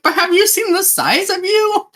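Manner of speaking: a small voice gasping up at a giant; high squeaky girl voice. Retold or imitated. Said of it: "And she's like".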